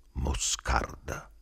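"Moscarda" mormorato piano, lento e soffiato; mormorio. Acted. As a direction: sussurralo lentamente, quasi senza voce. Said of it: "mormorai dopo un lungo silenzio". (mormorio lento, più piano e senza voce)

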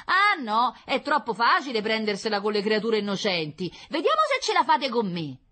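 loud, high-pitched defiant woman; brash concierge, challenging. Acted. Concierge dares the official to take her on, defiant. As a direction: square up to him, high and loud.

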